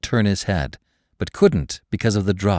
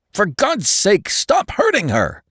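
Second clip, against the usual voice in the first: shouting; 14 semitones higher than usual. A narrator's reading. Captive man shouting at the torturer to stop, anguished yell. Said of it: "the American shouted".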